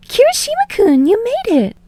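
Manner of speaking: put-on very high, thrilled girlish cry; high-pitched young woman, overjoyed; delighted greeting. A narrator's reading. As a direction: greet an old friend with pure joy. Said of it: "they let out a gasp".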